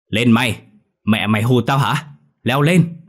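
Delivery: loud angry father's shouted orders; chửi rủa. A narrator's reading. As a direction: yell at him to climb up, ending high. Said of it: "Ông cứ đứng chửi rủa". (quát lớn, giận dữ)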